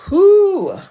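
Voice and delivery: big high loud exhale of relief; relieved whew. Real, not acted. Streamer done reading the long intro, a loud relieved whew.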